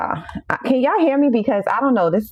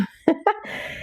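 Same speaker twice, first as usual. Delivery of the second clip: giggle; 14 semitones higher, 5 dB quieter than usual. Real, not acted. Laughing at a viewer compliment.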